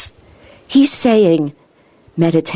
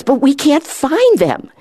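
retelling it higher and slower with rising excitement; excited; 7 semitones higher than usual. Retold or imitated. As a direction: let the wonder of finding nothing break through. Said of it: "And he's getting excited".

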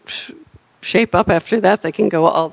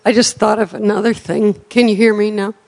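speaking up; faster than usual. Real, not acted. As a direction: project so everyone hears. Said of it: "Speak up, please".

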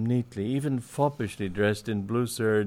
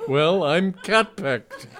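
slow, high, loud insistence; exasperated emphatic declaration; irritated; 8 semitones higher, 5 dB louder, slower than usual. A narrator's reading. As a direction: spell it out loudly and testily. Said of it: "with a touch of irritation".

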